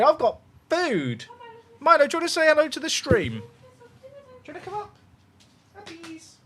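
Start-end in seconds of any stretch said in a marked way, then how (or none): 1.8-6.4 high cooing voice for the dog; pet talk voice. Real, not acted. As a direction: coo at the puppy. Talking to his dog.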